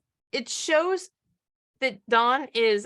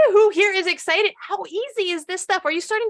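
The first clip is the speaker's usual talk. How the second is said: excited hype; 7 semitones higher, 5 dB louder than usual. Real, not acted. Hyping up the audience.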